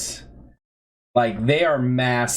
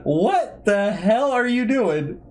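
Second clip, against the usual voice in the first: slow, drawn-out, higher disbelief; exasperated demand; 7 semitones higher, slower than usual. Retold or imitated. Narrator to coworker in the flowers, slow exasperated demand. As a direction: stretch every word out in disbelief. Finds coworker playing ocarina.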